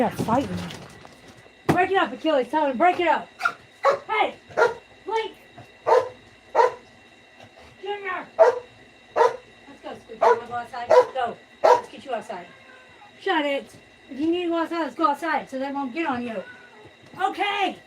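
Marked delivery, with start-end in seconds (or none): 1.7-3.3 loud, much higher commands; ordering cats. Real, not acted. Telling Achilles to end the fight, loud high orders. 4.1-8.3 very high pitched call; calling out names. Real, not acted. Calling her cats away from the fight, very high. 9.1-16.4 louder, higher coaxing; urging the cat. Real, not acted. Leading Squiggy outside, a loud high coax.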